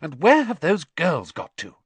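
cross, fussing question; snapping. A narrator's reading. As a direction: scold as an impatient mother. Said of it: "snapped Missus Weasley".

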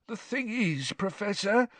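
high-pitched whining complaint; plaintive. A narrator's reading. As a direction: plead your grievance in a high voice. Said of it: "said Filch plaintively".

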